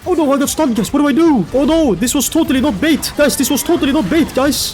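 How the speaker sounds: high, loud hammed-up panic; pretending to panic while baiting the enemy; mock panic. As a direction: sell the fake panic as bait. Fake panic, obvious bait.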